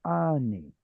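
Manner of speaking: "ani" as AH-nee, long first vowel, falling on "ni"; pausal form stress. Shown as a demonstration. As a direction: hold the "a" of "ani", then let it fall. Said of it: "So this one is".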